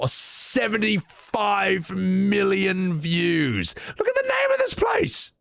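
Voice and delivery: high-pitched exclamation of amazement; astonished excitement. Real, not acted. Streamer reacting to a viral Welsh town name, astonished. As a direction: stretch the number out, then burst out high.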